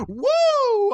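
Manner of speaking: high, loud drawn-out whoop; hammed-up falsetto holler; gleeful mock whoop. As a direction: whoop like a goofy prankster. Mimics Tom Green's gleeful antics.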